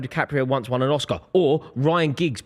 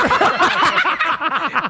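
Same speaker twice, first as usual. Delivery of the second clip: high laugh; 13 semitones higher, 5 dB louder than usual. Real, not acted. Cameraman zoom-in chaos.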